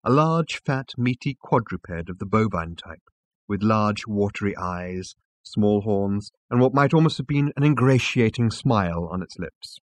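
ordinary delivery, nothing marked

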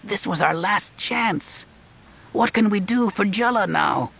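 put-on high desperate boy voice. A narrator's reading. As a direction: plead like a scared kid.

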